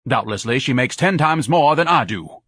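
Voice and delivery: loud, pompous indignation; indignant tirade. A narrator's reading. Son rants at his mother about frauds, loud indignant tirade. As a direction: scold her grandly for wasting money. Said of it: "Ignatius exploded".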